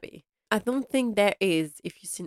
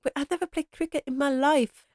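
high, lightly put-on voice of a protesting young man; helpless protest; 8 semitones higher than usual. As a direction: squeak out the excuse as if cornered. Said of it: "He's like".

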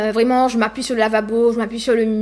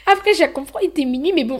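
ordinary talk, nothing marked

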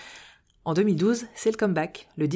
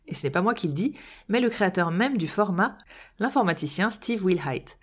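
normal talking voice

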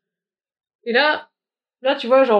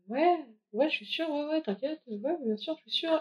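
soft eager yes; 5 semitones higher, 11 dB quieter than usual. Retold or imitated. Retold eager reply to her.

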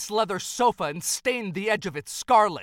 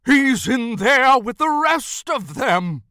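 loud, drawn-out alarm; horrified realisation; 4 semitones higher, 8 dB louder, slower than usual. Acted. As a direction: cry it out, higher and slower. Dawning horror about the other reindeer.

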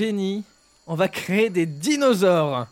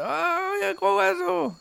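fillette niaise, très aiguë; voix de Bourville. Acted. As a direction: petite voix naïve, très haut perchée. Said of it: "la voix de Bourville". (voix de Bourville en fillette)

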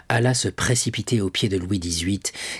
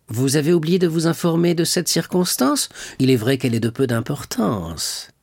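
crushing sarcasm; 6 semitones higher than usual. A narrator's reading. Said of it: "avec un sourire écrasant".

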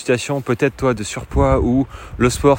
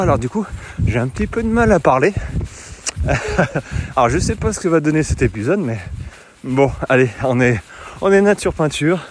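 ordinary delivery

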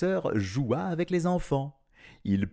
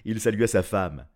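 ordinary delivery

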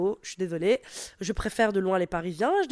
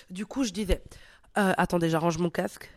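normal talking voice

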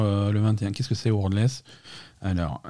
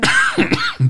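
sudden hacking cough; choking cough. Real, not acted. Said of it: "je m'étouffe".

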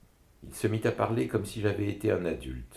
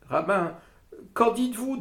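high, louder woman's voice; woman asking; 5 dB louder than usual. A narrator's reading. The woman presses the rabbi for his verdict, high voice. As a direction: ask the rabbi, much higher. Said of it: "demanda la femme".

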